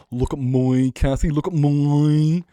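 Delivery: loud campy "look at moi"; mock diva. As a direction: play the vain diva calling for attention.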